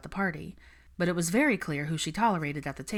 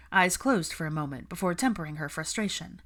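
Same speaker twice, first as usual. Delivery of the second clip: ordinary delivery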